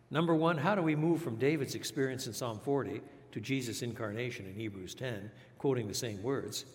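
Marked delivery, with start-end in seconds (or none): none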